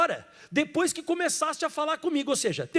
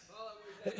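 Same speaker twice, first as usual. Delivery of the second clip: soft echo; 3 semitones lower, 19 dB quieter, faster than usual. Real, not acted. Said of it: "Fala assim em voz alta assim".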